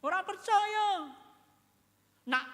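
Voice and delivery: high, slow, loud cry of disbelief; incredulous disciple insisting he won't believe; doubting Thomas. Acted. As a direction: throw out Thomas's stubborn refusal to believe.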